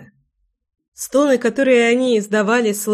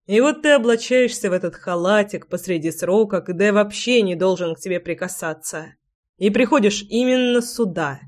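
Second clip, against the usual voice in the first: plain reading voice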